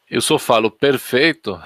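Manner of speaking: normal talking voice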